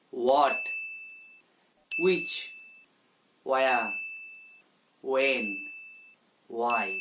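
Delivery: "What, which, where, when, why" each opening on w, no h; silent h. Shown as a demonstration. Teacher recapping for learners, "What, which, where, when, why" with silent h. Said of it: "সঠিকভাবে উচ্চারণ করব".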